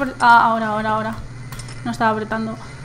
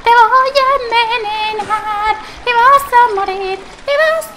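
high, hammed-up sinister singsong; mock villain voice; 8 semitones higher than usual. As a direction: gloat like a cartoon poisoner. Menacing villain voice. (voz de villano exagerada)

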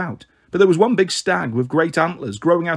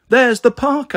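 higher, louder glad discovery; excited; 8 semitones higher, 5 dB louder, slower than usual. Acted. Girl spotting the park gates for the siblings, excited and higher. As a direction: point it out with sudden joy.

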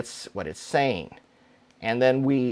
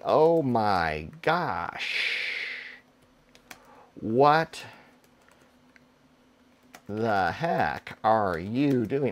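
mock dismay; 4 semitones lower, 4 dB quieter, much slower than usual. Shown as a demonstration. Reading emotional test sentence.

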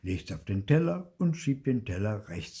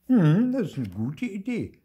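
lightly raised, higher mother's voice; a brighter take on Mama agreeing; 12 semitones higher, 9 dB louder than usual. A narrator's reading. Mother agreeing with Papa's bike plan, higher and louder.

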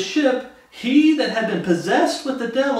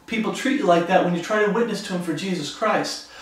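ordinary talk

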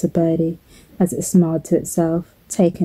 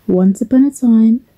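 lilting, lifted storyteller's opening; storybook opening; 3 semitones higher, 3 dB louder than usual. Acted. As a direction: open a bedtime tale with a flourish. Begins the story after "here goes.

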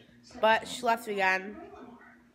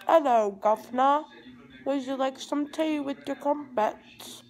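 mock British accent; 4 semitones higher, 4 dB louder than usual. Girl showing off her British accent in a vlog, a playful put-on British accent. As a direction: ham up a posh British accent for fun. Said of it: "I'm really good at a British accent".

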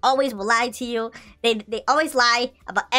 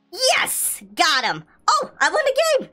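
high-pitched victory whoops; triumphant cheering; 5 semitones higher, slower than usual. Real, not acted. Player cheering a won game, triumphant. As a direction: celebrate with squealing, leaping pitch.

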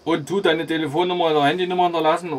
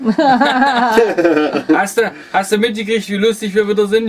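laughing; 7 semitones higher, 6 dB louder, slower than usual. Real, not acted. Laughing while teasing radio partner.

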